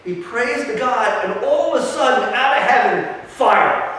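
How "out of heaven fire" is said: usual speaking voice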